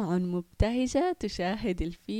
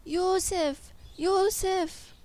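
high, raised searching call; 9 semitones higher, 4 dB louder, much slower than usual. A narrator's reading. Searchers walk on looking for the lost boy, calling out. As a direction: shout the name out into the distance. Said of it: "ومضوا وهم ينادون".

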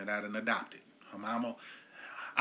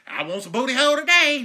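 high, loud, hammed-up come-on; mock horny inmate; 11 semitones higher, 10 dB louder than usual. Inmate strolling into jail announcing what he wants, mock loud. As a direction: belt it out like a cartoonish jailhouse pickup line. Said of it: "just him showing up and say".

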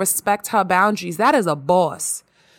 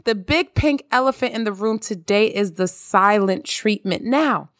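normal talking voice

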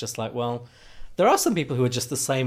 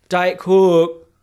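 loud, high broad Yorkshire "Coke"; insistent repeat with the Yorkshire vowel; Yorkshire accent; 6 semitones higher, 10 dB louder than usual. Retold or imitated. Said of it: "where I don't have to repeat myself".

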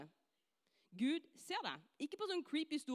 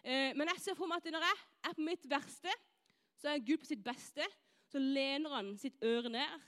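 ordinary delivery

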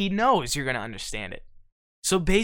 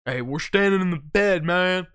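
loud, hammed-up country buddy talking over the truck bed; a big mock country guy; country drawl; 2 semitones higher, 4 dB louder than usual. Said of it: "they always call it".

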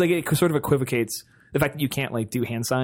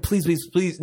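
mild high begging; 4 semitones higher, slower than usual. Retold or imitated. Player begging a killer for mercy. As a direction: plead in earnest. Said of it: "Now I'm begging like a real fucking person".

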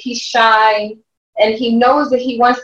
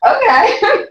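laughing okay; 13 semitones higher than usual. Real, not acted. Laughing at son's reply.

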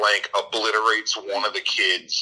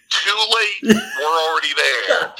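high, louder singsong tease; teasing Zooey; 13 semitones higher, 5 dB louder than usual. Retold or imitated. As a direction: tease him, it's already done.

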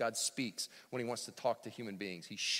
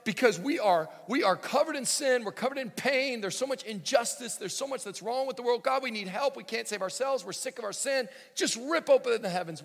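loud, high preaching plea to God; impassioned prayer; 10 semitones higher, 8 dB louder than usual. Acted. Preacher to congregation, pleading loudly for God to come down. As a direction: cry out to heaven at full force. Pastor prays Isaiah's cry to God.

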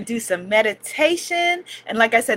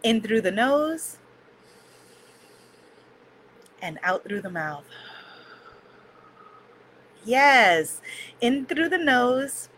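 soft spa whisper; 6 dB quieter than usual. Acted. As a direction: breathe the words out gently. Leads a breathing meditation.

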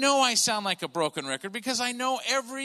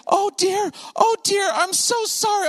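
put-on high, loud old-lady voice, apologizing; flustered grandma; 13 semitones higher, 5 dB louder than usual. Grandma apologizes for hitting boy with cart, flustered. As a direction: squeal it out like a dismayed grandma. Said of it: "this nice grandma lady".

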